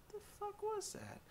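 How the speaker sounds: high, very soft wary question; hushed wary dog. Retold or imitated. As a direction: breathe it out like an ears-up dog listening. Dog voiced, listening, ears perked.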